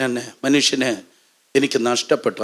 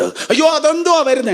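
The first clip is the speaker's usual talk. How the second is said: acted alarm.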